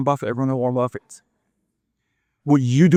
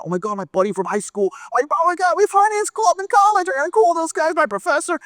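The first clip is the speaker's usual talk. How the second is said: high voice climbing with each new thought; giddy excitement. Acted. Man thinks of everyone to call, giddily excited. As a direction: get more worked up as names occur to you. Remembering more people to call.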